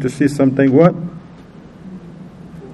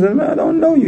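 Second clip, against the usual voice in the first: high-pitched mock disavowal; retold protest of innocence; acted indignant denial; 12 semitones higher than usual. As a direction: deny it, pitch leaping up.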